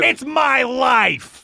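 high, drawn-out protest; angry shout. Acted. Big brother defends himself when told to apologize, angry. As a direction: yell it defiantly, stretching the words. Acted quarrel between brothers.

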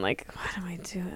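hushed, voiceless self-questioning; whispered self-doubt. Retold or imitated. Retelling a man stuck again, whispered dismay. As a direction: whisper it to yourself as the trapped character.

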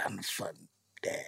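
breathy aside, half voice; hushed joke about being the fun dad; whisper. Real, not acted. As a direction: whisper it like a secret boast. Whispered repeat of fun guy line.

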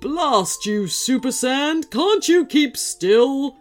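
building, hammy outburst of frustration; angry howl. A narrator's reading. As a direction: howl in exasperation as a thwarted wolf. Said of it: "howled Howlerg angrily".

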